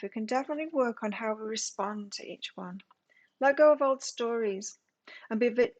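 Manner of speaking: emphatic reading voice, lifted at phrase starts; card reading. Real, not acted. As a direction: read the guidance out with purpose. Reads card guidance aloud.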